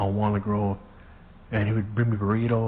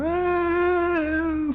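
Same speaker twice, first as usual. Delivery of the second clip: mock crying wail. Kid crying after punch.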